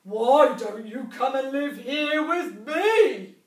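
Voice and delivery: very loud, slow booming bear; a huge bear rumbling a welcome; growling bear. A narrator's reading. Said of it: "growled a huge bear".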